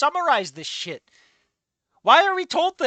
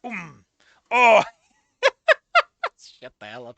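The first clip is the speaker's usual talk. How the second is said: ordinary delivery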